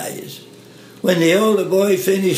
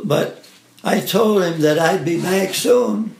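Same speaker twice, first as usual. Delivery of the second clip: ordinary delivery, nothing marked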